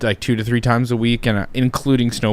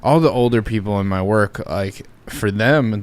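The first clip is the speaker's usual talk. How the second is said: ordinary talk, nothing marked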